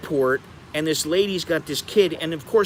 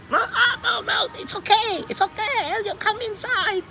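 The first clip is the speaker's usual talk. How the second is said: much higher, squeaky voice with a laugh, playing the squatting man; high squeaky imitation. Retelling the squatting man's cheerful invitation, high mock voice. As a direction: mimic the man on the toilet, squeaky and amused.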